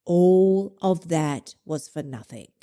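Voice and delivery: low, slow mock verdict; condemning inner voice. Inner critic writes off three days' progress, grave slow condemnation. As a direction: pronounce it slowly, dragging out ALL. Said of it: "the voice is gonna say to you".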